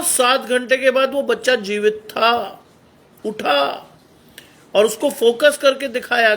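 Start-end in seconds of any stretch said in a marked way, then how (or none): none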